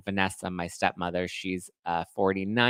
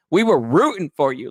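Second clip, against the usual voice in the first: Tyra Banks quote; 6 semitones higher, 7 dB louder than usual. Retold or imitated. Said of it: "like Tyra Banks was saying".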